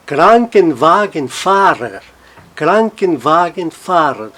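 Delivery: slow Krankenwagenfahrer, KRAN strongest, WA and FAH lighter; stress on Kranken. Shown as a demonstration. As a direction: spell out Krankenwagenfahrer slowly, leaning on KRAN. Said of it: "O Kranken toma um som um pouquinho mais forte". (Krankenwagenfahrer devagar, Kranken mais forte)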